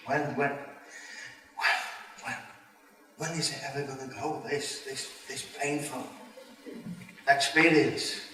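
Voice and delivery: quiet despair. Retold or imitated. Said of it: "crying, thinking".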